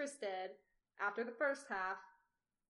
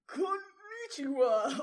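high, loud, long-drawn greeting as a hearty father; hammed-up dad; 9 semitones higher, 7 dB louder, much slower than usual. Emperor dad greets an old friend, hammed-up drawn-out greeting. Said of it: "Okay, I'll do dad".

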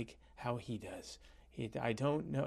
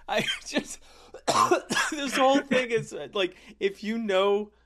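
high, loud, laughing through the words; real laughter breaking up the sentence; 8 semitones higher, 8 dB louder than usual. Real, not acted. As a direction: crack up while trying to talk. Cracking up at the tow-truck joke.

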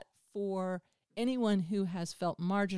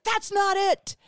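much higher, louder playful yell; shout; 12 semitones higher, 7 dB louder, slower than usual. Retold or imitated. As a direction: call it out sharply at the crowd. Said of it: "and I was like".

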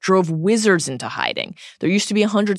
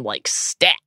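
ordinary delivery

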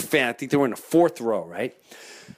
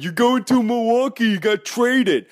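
lightly voiced taunting kid, louder; 7 semitones higher, 4 dB louder than usual. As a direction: call it out like a teasing boy. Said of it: "the kid's in front of me going".

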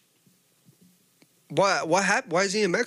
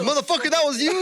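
half-laughing higher yell of recognition; mock indignant yell; 10 semitones higher, faster than usual. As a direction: call him out, voice jumping up. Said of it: "I was like".

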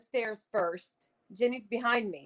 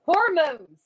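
joking; 5 semitones higher, 7 dB louder than usual. Real, not acted. Joking answer, then bursts laughing.

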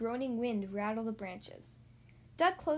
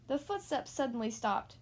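ordinary delivery

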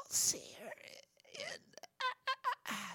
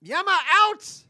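shouting; 13 dB louder, slower than usual. Pretend. Silly ninja exit shout.

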